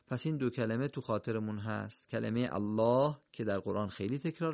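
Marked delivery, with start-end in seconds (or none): none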